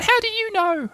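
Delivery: very high, squeaky indignation; little creature put out at being told on; Roo, indignant. Acted. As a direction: protest in a tiny high voice. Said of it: "asked Roo indignantly".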